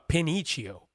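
Pinocchio said pin-EE-chee-oh; deliberate mispronunciation. Shown as a demonstration. Showing how they like to say it, Pinocchio as pin-EE-chee-oh. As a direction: swap the "ok" of Pinocchio for "eech". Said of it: "Well, I like to say".

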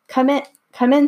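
a hint of the wizard calling out cheerily; Oz inviting in. Child voices Oz greeting Scarecrow.

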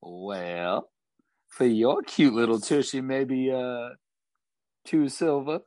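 lightly voiced Southern barmaid; a touch of a Southern barmaid accent; flirty Southern accent. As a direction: tease him in a Southern accent. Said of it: "Thornbuckle's accent".

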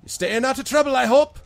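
high, loud constable's challenge; village constable. Acted. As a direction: question them loudly as a wary constable. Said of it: "she looks at all of you".

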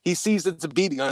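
plain reading voice

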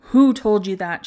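loud, furious demand; fierce demand. Acted. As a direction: bark it at her, fiercely. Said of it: "she demanded, so fiercely".

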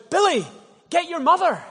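high, loud panicked yell across the hotel foyer; country dad hollering for his son; shouting. Acted. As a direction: bellow it out to the kid, alarmed. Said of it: "He shouted".

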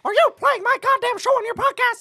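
Mickey Mouse. Retold or imitated. Voices Mickey Mouse complaining.

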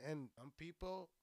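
normal talking voice

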